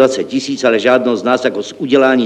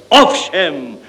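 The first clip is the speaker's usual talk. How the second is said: self-assured, superior confirmation; 4 semitones higher than usual. A narrator's reading. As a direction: confirm from above, pitch raised. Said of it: "potvrdil s převahou hlavní účetní".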